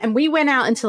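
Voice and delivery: usual speaking voice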